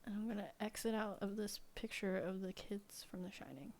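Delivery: soft breathy whisper. Real, not acted. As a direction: whisper the aside quietly.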